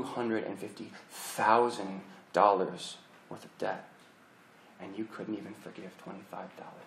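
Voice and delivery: low, hushed and slow, almost breathed, disgust dripping; menacing hushed rebuke. Acted. President to the student who wouldn't forgive, hushed menacing rebuke. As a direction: lean in close and let the contempt simmer quietly.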